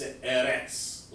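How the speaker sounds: slow "Eretz", stretched first eh; "Eretz" with short final E and ts; mimicked mispronunciation. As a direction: imitate "Eretz" slowly as ehh-rets. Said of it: "like that".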